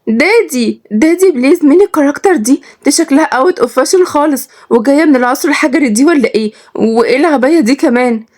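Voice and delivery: put-on very high-pitched whiny rich girl; spoiled daughter. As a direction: whine to daddy in a high affected girly voice. (بنت مدلعة بصوت رفيع)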